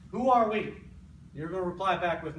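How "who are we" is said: loud call to the team. Shown as a demonstration. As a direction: call it out like a coach. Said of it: "what I'm gonna say is".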